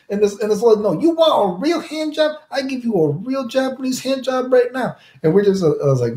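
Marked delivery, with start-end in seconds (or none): 0.8-5.0 high put-on accented old lady voice; old Chinese lady. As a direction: mimic a pushy old street hustler, pitched way up.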